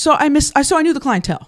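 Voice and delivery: amped up telling it; excited. Real, not acted. Excited telling, high and loud.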